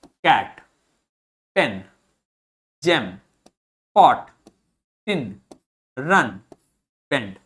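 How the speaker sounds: कैट, पेन, जेम with short vowels, one word at a time; isolated words like कैट and बेंड, each vowel kept short; short vowel sounds. Shown as a demonstration. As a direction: model पॉट, टिन, रन with short, lax vowels. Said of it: "सारा का सारा sound कैसा होगा? Short".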